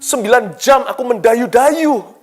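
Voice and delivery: protesting complaint. Retold or imitated. Believer protesting to God after nine hours rowing, loud exasperated complaint. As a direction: whine that it's easy for him to say. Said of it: "Mungkin kita bisa protes".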